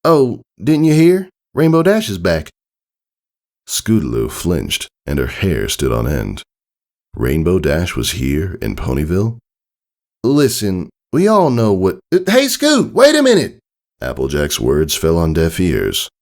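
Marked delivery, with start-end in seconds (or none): 0.0-2.5 high, louder awkward country mare; wincing farm pony breaking news; uneasy farm mare. Acted. Applejack grimaces, explains. 10.2-12.0 a hint of a serious country mare; lightly voiced farm mare. Applejack starts explaining. 12.1-13.6 high, loud call after her. Acted. Calling after fleeing Scootaloo.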